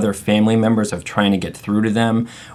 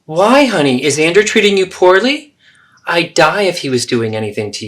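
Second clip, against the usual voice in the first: higher, worried pitch jumping up; alarmed mother; 8 semitones higher than usual. Acted. Mother fearing her daughter's partner is cruel, alarmed. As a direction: fret as if her daughter's in danger. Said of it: "So there you have the three role plays".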